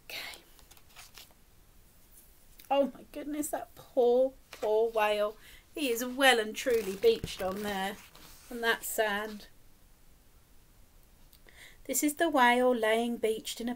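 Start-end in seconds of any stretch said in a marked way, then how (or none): none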